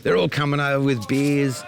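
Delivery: normal talking voice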